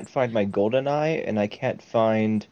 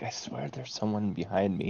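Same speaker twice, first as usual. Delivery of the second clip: nervous whisper; 11 dB quieter than usual. Retold or imitated. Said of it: "She's like".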